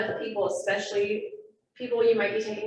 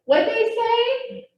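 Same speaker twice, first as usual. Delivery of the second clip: much higher, louder and slow mock kid call; kid in the back; 14 semitones higher, 8 dB louder, much slower than usual. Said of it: "the kid in the back is like".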